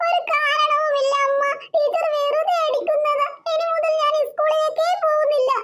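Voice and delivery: put-on sulky little girl. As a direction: play a small girl refusing school. Child Devu insists, slowly. (പിണങ്ങിയ കൊച്ചുകുട്ടിയുടെ ശബ്ദം)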